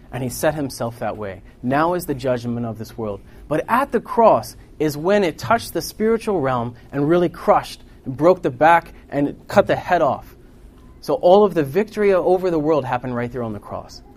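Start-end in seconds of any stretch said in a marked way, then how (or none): 3.5-14.0 loud, high, punched sermon phrases; forceful preaching. Real, not acted. Preacher on the world crushed at the cross, forceful preaching. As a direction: hammer the victory home.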